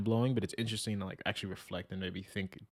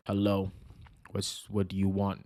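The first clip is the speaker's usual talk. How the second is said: mock scary voice; 3 dB louder than usual. Imagined professor coming on a call unsmiling, mock scary. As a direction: play an intimidating professor, half-jokingly. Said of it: "a scarier voice".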